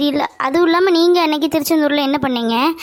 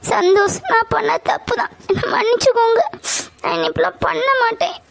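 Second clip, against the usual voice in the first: high-pitched tearful pleading; crying; 7 semitones higher than usual. Acted. Girlfriend begging her boyfriend's forgiveness, crying. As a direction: sob out the apology in a raised voice. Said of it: "Sathya அழாத".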